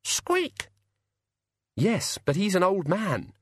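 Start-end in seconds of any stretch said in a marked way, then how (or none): 0.0-0.7 squeak. A narrator's reading. Raven squeaks.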